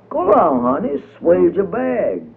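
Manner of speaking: put-on old storyteller gently explaining to the little boy; indulgent old storyteller. A narrator's reading. Uncle Remus explaining to boy.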